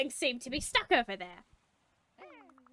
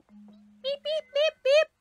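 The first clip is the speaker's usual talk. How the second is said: tiny high-pitched beeps; squeaky falsetto chirps; chick language; 6 dB louder, slower than usual. Pretend. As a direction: peep like a baby bird. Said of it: "I can speak their language".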